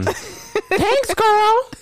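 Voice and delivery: high sugary mock thank you; mock sweet guest. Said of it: "being like".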